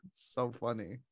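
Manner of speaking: ordinary talk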